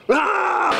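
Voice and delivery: sudden high "Ah!" like a clown leaping out; scary jump-out yell. Retold or imitated. Masked clown jumping out at her, high startling yell. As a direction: bark it high and sharp to startle. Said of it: "he jumps out with a scary mask".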